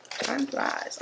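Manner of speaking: breathy whisper, barely voiced; whispered time flies; whispered aside. Real, not acted. Wistful aside while unboxing.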